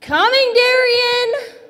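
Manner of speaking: loud, high call to another room; calling back. Acted. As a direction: holler your answer back. Calls back to Darian's shout.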